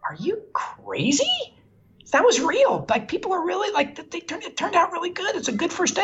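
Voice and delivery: pitched high, disbelieving then encouraging; incredulous reassurance. Retold or imitated. Producer telling Phil the dailies were good, high incredulous reassurance. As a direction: laugh off his fear, high and bright.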